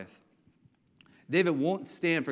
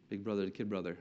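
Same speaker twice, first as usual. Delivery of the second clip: ordinary talk, nothing marked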